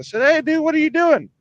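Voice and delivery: high call-out. Retold or imitated. Voicing himself calling to kid.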